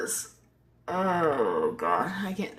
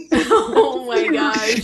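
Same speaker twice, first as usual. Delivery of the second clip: slow, louder, laughing through the words; 6 semitones higher, 7 dB louder, much slower than usual. Real, not acted. Host laughing along while recalling a funny live stream. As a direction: let the laugh carry the words, stretched out.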